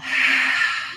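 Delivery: long sighed-out breath; audible exhale. Shown as a demonstration.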